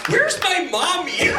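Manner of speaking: put-on high, loud, slow whimpering child voice; scared kid. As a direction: wail it like a frightened little boy.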